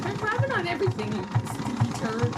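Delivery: mock-scold the clingy cat; teasing squeal at the cat; playful exclaim. Real, not acted.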